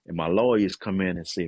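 usual speaking voice

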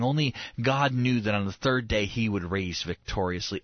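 usual speaking voice

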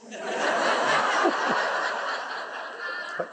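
real silent wheezing laugh, trailing off; a real unvoiced chuckle fading out; breathless laugh. Real, not acted. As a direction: laugh airily at the punchline. Laughs after wife's line.

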